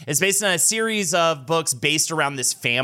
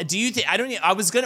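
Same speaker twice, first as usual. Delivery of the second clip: ordinary talk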